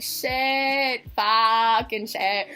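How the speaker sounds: slow, drawn-out curse; re-enacted dismay. Hearing they might not see band, drawn-out dismay. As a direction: drag out each swear in dismay. Retold reaction to missing band.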